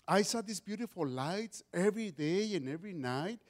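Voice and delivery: normal talking voice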